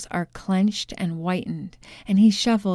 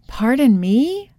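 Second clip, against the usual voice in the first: higher, louder, slow pardon me; sharp pounce; 5 semitones higher, 6 dB louder than usual. A narrator's reading. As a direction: seize on his words, sharply. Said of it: "Inga pounces upon Arthur's words".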